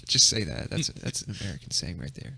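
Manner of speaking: ordinary delivery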